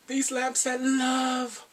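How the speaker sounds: high, slow, drawn-out proclamation; pitching the product. Retold or imitated. Viewer acts out her pitching lamps, high and drawn-out.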